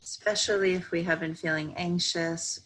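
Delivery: slow, even meditation voice with long pauses; calm, measured yoga nidra guidance; guided meditation. Real, not acted. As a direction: guide them gently into stillness, unhurried.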